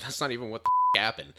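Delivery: retold angry snap, hammed up; mock angry outburst. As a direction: blurt it out in mock outrage.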